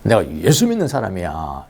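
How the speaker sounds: normal talking voice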